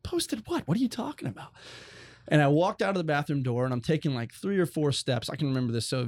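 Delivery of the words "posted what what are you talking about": much higher, alarmed confused questions; retold confusion. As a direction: replay your teenage panic. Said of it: "I was like".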